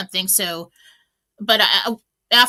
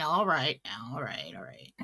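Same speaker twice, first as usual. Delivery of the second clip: fading to a whisper, much lower and softer; calming herself; 10 semitones lower, 9 dB quieter than usual. Retold or imitated. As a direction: talk yourself down until it's almost silent.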